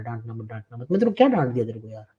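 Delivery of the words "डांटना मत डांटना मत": soft, whiny mimic of a timid student; hushed mock plea, sing-song rise; mocking whine. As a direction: repeat it like a scared kid begging. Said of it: "ऐसे क्यों behave करता है यार".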